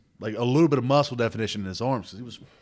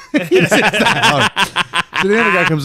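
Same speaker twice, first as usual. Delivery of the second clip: louder, higher, laughing through; can't stop laughing; laughing as he tells it; laughing while talking; 7 semitones higher, 8 dB louder than usual. Real, not acted. Laughing while telling the story.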